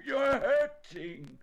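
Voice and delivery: high, loud, slow complaint; pained cry. A narrator's reading. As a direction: cry out as a hurt bear. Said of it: "cried Winnie the Pooh".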